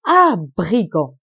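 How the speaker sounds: loud, drawn-out cry of accusation; exclaimed. A narrator's reading. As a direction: cry out at a caught villain, slowly. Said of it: "s'écria monsieur de Sairmeuse".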